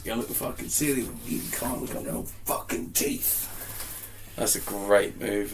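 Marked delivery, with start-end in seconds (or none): 0.0-3.5 film character quote. Retold or imitated. Co-host quotes Blues Brothers, hushed rough threat. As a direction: growl out a movie threat under your breath. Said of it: "there's a line where he goes".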